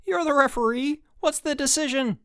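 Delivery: high-pitched urgent appeal; calling out. A narrator's reading. Chip appealing to Webster the referee, calling out. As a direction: demand a ruling, voice pushed high. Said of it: "called Chip".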